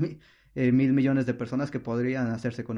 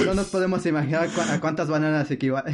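laughing; 5 semitones higher, 3 dB louder, faster than usual. Real, not acted. Laughs at huge banana number.

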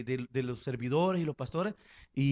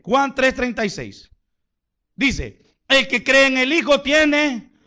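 preaching at full force; 8 semitones higher, 10 dB louder than usual. Acted. Preacher reading John 3:36 to the church, loud preaching. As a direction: thunder the verse at them.

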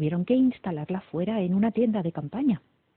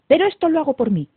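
loud, resolute princess; determined, self-assertive announcement; firm; 8 semitones higher, 9 dB louder, faster than usual. A narrator's reading. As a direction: declare it with firmness. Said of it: "dijo Rosamunda con firmeza".

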